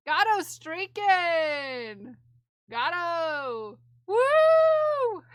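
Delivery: drawn-out cheer, then a high whoop; excited cheer. Real, not acted. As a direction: hype up the streak. Cheering a chatter's streak.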